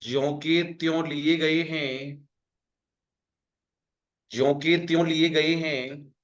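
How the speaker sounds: slow dictation, each word spaced; slow it down for note-taking; dictation pace. Shown as a demonstration. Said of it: "लिखो".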